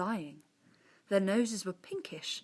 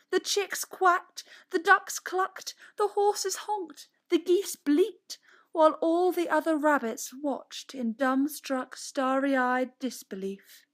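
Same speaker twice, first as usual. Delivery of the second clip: higher, louder and slower, full of wonder; excited narration; 6 semitones higher, 7 dB louder than usual. A narrator's reading. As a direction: tell the farm's uproar with amazement. Farm erupts in amazement.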